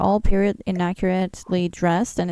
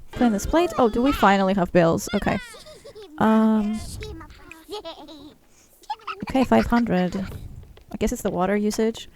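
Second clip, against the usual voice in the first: ordinary talk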